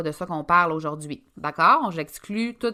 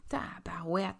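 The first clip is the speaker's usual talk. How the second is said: muttered mild oath; 9 dB quieter, slower than usual. Real, not acted. Coach stuck for a word, whispering to herself. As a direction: swear under your breath at a lost word.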